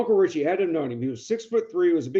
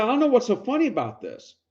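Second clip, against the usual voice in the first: higher-pitched, lightly acted bewilderment; mild indignant protest; 8 semitones higher than usual. Nephew objects to his laughing uncle, mildly indignant. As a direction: protest in a raised pitch, mock upset.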